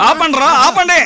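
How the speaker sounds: stop shout. Acted. Boss stops men beating him.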